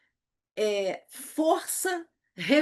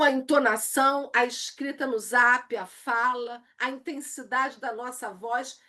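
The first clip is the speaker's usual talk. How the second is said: ordinary talk, nothing marked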